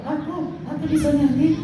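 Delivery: high, soft little puppet voice; monkey puppet voice. Acted. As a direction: squeak it like a shy monkey puppet.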